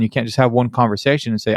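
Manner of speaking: usual speaking voice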